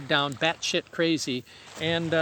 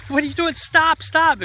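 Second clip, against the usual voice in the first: much higher, louder frantic yelling; panicked passengers yelling at a reckless driver; retold panicked scream; 10 semitones higher, 6 dB louder than usual. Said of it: "Heil and I are screaming at you".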